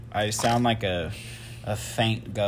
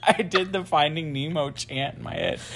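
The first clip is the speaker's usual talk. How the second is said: giggly, higher-pitched confession; amused, laughter breaking into the words; laughing; 5 semitones higher, 5 dB louder than usual. Real, not acted. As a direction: talk through real giggles.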